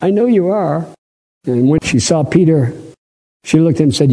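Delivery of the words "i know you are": put-on servant girl voice. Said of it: "The little lady said".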